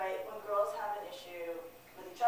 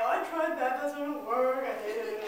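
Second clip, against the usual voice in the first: miserable whine; 2 semitones higher, 7 dB louder than usual. Retold or imitated. Retold whiny call to mother.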